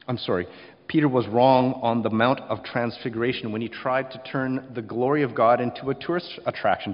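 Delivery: normal talking voice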